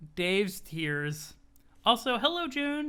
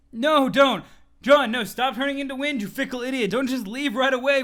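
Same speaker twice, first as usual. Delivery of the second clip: loud, higher yell at the screen; mock yell; 5 semitones higher, 8 dB louder than usual. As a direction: holler at him to stop. Yelling at character leaving.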